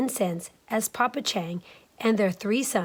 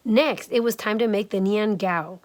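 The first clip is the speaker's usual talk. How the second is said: ordinary delivery, nothing marked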